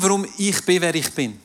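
ordinary talk

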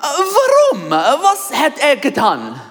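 high, loud sung-out question; Pilate pleading. Pretend. Preacher as Pilate, calling out to the crowd. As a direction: cry out why as Pilate. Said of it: "I'm going to be Pilate".

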